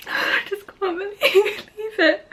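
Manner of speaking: high, crying voice, words catching; tearful disbelief. Real, not acted. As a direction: choke the words out through joyful tears. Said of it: "I don't know if I've cried on camera before".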